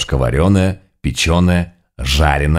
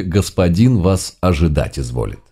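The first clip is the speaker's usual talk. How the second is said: plain reading voice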